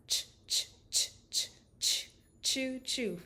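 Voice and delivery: puffed "ch, ch" building to "choo, choo"; choo-choo train. Shown as a demonstration. Therapist playing the choo-choo train sound. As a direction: chug like a train. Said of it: "think about the choo-choo train sound".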